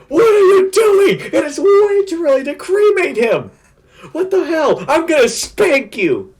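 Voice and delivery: very high, loud cartoon scolding; hammed-up high, slow outrage; mock outraged shout. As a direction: shriek it like a scandalised cartoon character. Retold outraged scolding while grabbing her.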